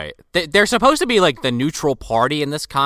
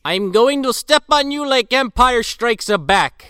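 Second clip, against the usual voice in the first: put-on Russian accent; 7 semitones higher than usual. Voicing Alexander in Russian accent.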